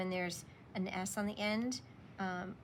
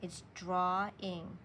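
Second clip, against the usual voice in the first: "drawing" stretched slowly into two syllables; much slower than usual. Shown as a demonstration. Teacher slowly splits "drawing" for students. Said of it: "remember that's two syllables".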